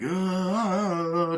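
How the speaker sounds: high, drawn-out imitation Tarzan call; Tarzan yell. Man pretending to be Tarzan over the water, mock yell. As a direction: do a playful Tarzan holler. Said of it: "pretending to be Tarzan".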